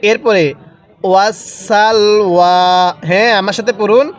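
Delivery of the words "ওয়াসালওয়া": ordinary delivery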